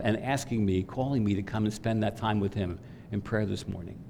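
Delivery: usual speaking voice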